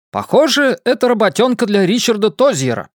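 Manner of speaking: newsreel announcer voice, high and bold; 1950s narrator. Acted. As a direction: boom it like an old newsreel announcer. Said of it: "голосом диктора кинохроники".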